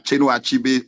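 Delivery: ordinary delivery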